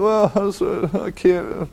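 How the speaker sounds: hammed-up high whimper of a sad sack; whiny patient. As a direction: whine like a crybaby patient. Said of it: "And the guy goes".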